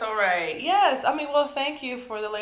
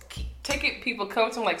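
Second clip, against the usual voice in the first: ordinary talk, nothing marked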